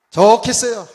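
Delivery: loud, high-pitched retold complaint; angry sarcasm. Preacher re-enacting his prayer in anger at God, sarcastic. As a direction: throw sarcastic praise up at God, acting it out. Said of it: "얼마나 내가 하나님께 화가 나는지".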